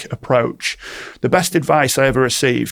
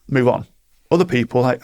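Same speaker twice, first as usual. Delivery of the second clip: normal talking voice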